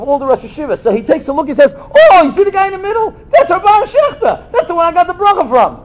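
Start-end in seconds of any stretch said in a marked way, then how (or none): 1.8-5.8 higher, louder, rushing with excitement; excited bragging. Retold or imitated. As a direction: gush like a kid who can't contain it. Boy points at newspaper photo.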